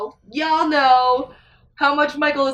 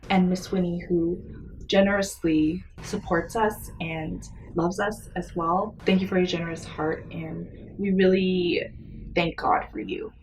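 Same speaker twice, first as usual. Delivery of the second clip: ordinary talk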